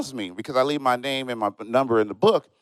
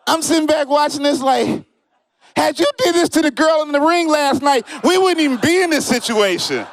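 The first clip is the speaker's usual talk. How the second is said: excited laughing shout; 12 semitones higher than usual. Real, not acted. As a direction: shout it, cracking up at the fight. Excited yelling watching fight.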